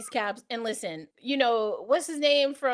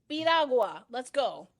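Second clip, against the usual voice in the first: ordinary talk, nothing marked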